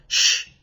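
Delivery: a hissed "Sh", no voice; hissing sound. Shown as a demonstration. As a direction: sustain "Sh" as a pure hiss. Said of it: "a sort of buzzing or hissing noise".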